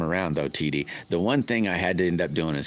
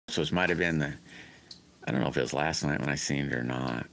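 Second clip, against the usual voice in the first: ordinary talk, nothing marked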